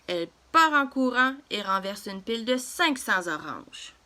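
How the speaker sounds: usual speaking voice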